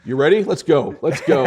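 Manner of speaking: psyched up and ready to charge; fired up. Real, not acted. Host fired him up, so he shouts he's ready. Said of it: "You got me fired up now".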